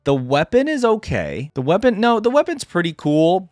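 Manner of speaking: ordinary talk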